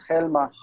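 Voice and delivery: HELMA with the stress on the first syllable, HEL-ma; wrong stress. Shown as a demonstration. Teacher correcting the student's reading, wrong stress HEL-ma for Helma. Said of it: "it's the one before the end".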